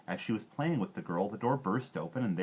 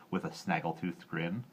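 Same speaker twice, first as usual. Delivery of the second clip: usual speaking voice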